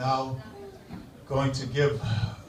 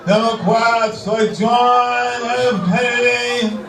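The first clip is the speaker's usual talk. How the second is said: Menino impression; 4 semitones higher than usual. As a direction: stumble through a speech like Mayor Menino. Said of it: "my tribute to the outgoing mayor, Tom Menino".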